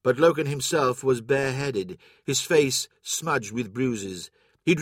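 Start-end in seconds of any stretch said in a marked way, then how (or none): none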